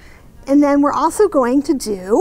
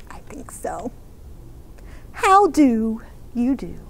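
story narration; 3 semitones lower than usual. A narrator's reading. Reads the picture book aloud.

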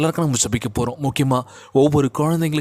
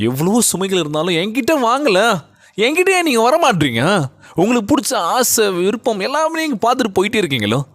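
fervent plea; 8 semitones higher than usual. Acted. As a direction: plead with the listeners, pitched way up. Preacher voicing Jesus's plea.

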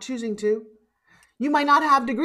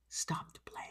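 whisper; 19 dB quieter than usual. Acted. Softly repeating key line.

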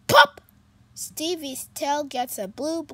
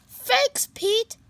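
higher, bright thank-you; grateful thanks; 10 semitones higher, 6 dB louder than usual. Acted. Stevie thanking his friend, grateful. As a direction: thank him warmly.

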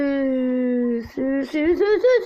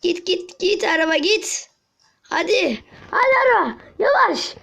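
high pleading urges; high excited coaxing; urging the car; 6 semitones higher than usual. Real, not acted.

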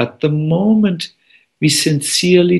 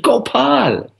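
high-pitched storyteller's call for help; mock shout; 10 semitones higher than usual. Frightened boy calls Gopal in the forest, high shout. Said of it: "he shouted".

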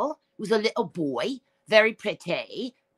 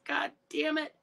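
unvoiced grief-stricken whisper; whispered curse; 7 semitones higher, 6 dB quieter than usual. Real, not acted. Mourning Max, whispering god damn it. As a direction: breathe it out in despair.

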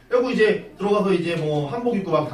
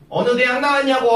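a sharp, louder and higher demand; demanding mother-in-law; 7 semitones higher, 5 dB louder than usual. Retold or imitated. Mother-in-law interrogating her son's girlfriend, sharply raised voice. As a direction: snap the question at the suitor.

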